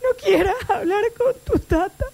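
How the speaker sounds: high, slow, tearful grandpa voice, far from her own; imitating grandpa crying. Granddaughter imitates her crying grandfather on the phone, tearful and high. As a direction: mimic an old man weeping over the phone. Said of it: "Esa voz me lloró".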